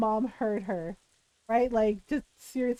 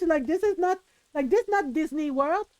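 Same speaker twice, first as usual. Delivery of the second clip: ordinary delivery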